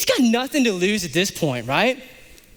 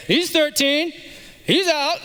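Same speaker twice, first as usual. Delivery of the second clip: mock parents; 9 semitones higher, slower than usual. Parents voiced dumping son.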